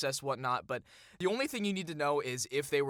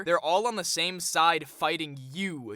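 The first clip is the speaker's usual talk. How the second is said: normal talking voice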